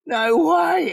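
high, amazed exclamation; retold disbelief. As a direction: re-enact being stunned, pitch way up. Retelling their disbelieving reaction.

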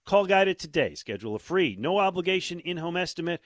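punchy radio ad read; infomercial. Acted. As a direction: sell it like a live commercial. Live ad read.

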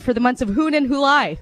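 "Hoon and Hulai" with an H for each J; J as H joke. Retold or imitated. Driver jokes back with H for J, Hoon and Hulai. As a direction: June and July said Hoon and Hulai, H sounds. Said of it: "the guy driving the car said".

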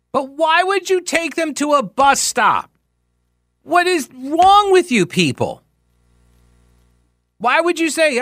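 high, pleading disbelief at a silly plan; exasperated rant. Real, not acted. Radio host ranting at transit planners, exasperated and pleading. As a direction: throw up your hands in disbelief.

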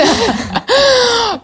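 high, loud burst of laughter; laughing. Real, not acted. Guest joking about being quoted, high laughing burst. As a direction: crack up at your own disclaimer. Laughs at her own disclaimer.

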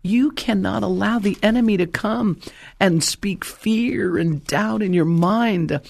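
high, pressing preacher's appeal; full-force sermon cry, pitched high; impassioned preaching. Real, not acted. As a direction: urge the congregation with rising fervor.

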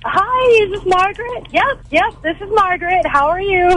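high, lilting phone hello; sweetly high, singsong greeting; phone greeting act. Retold or imitated. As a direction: re-enact both sides of a friendly phone call, pitched well up. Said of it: "Do you put on a voice?".

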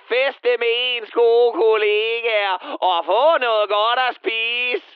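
stretched-out, put-on protest; mocking colleague. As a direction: parody a cheery party-lover, dragging out the words. Mocks a colleague defending the party.

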